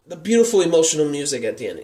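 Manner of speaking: normal talking voice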